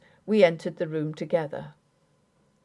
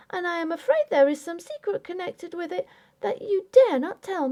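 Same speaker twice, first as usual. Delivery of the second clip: put-on high, pleading young wife voice; raised voice of a wounded young bride; young wife Grace; 12 semitones higher than usual. A narrator's reading. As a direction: voice Grace, hurt and insistent. Grace's long accusing speech.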